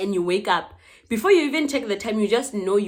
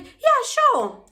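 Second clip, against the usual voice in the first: mock sweet agreement; 5 dB louder than usual. Narrator fakes agreement to a stranger.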